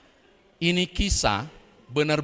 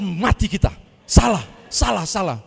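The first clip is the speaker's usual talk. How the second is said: panicked pastor; 5 semitones higher, 9 dB louder than usual. Retold or imitated. Pastor panics at his mistake.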